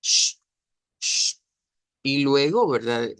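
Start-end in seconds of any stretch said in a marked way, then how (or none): 0.0-1.4 "sh" as a long voiceless hush sound; isolated "sh" consonant. Shown as a demonstration. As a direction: say "sh" in place of S. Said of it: "esa S se convierte en S H".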